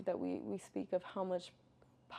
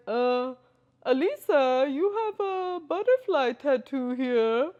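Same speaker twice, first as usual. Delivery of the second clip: doctor's voice; 6 semitones higher, 12 dB louder, slower than usual. Retold or imitated. Doctor quoted mid-emergency story.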